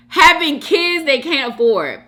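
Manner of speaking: high, loud read-out in disbelief; amazed loud repeat. Real, not acted. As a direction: repeat it loudly, scandalized and amused.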